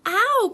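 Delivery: loud high Ow; loud high pained cry; Piglet's cry. A narrator's reading. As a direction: yelp under the scrubbing. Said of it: "cried Piglet".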